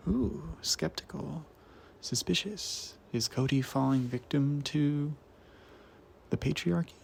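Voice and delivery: hushed, teasing mock suspense; mock suspicion. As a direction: drop to a soft, playfully suspicious aside. Mock suspense about reading a man.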